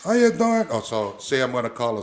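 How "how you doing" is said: much higher and louder, a put-on wrestler's greeting; Jimmy Snuka impression. Guest greets the host as Jimmy Snuka, in a high, loud impression. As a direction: open as Jimmy Snuka, pitch way up. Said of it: "impersonation, uh, I think, of Jimmy Snuka".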